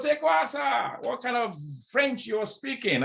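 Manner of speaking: very high, scornful mock caller; rude French caller. French caller mocking the agent's accent, high scornful jab. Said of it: "He goes".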